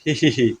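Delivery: soft hee-hee snicker; acted, stifled little giggle; sneaky giggle. Havaldar secretly sliding the boss's chair back, sneaky giggle.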